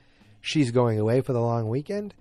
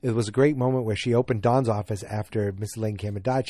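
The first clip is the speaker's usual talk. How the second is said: usual speaking voice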